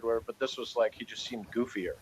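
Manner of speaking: ordinary delivery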